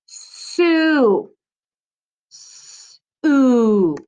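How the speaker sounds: Su- then a hissed s then a stretched oo; slow letter-by-letter sounds; sounding out. Shown as a demonstration. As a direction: sound out the first part of Superman. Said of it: "Let's start at the beginning".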